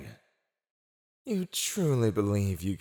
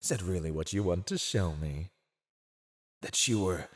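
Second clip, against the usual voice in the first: ordinary talk, nothing marked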